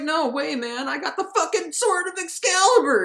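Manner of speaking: put-on high-pitched cocky kid yell; excited kid. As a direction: crow it like a triumphant cartoon kid. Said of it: "And then the kid's like".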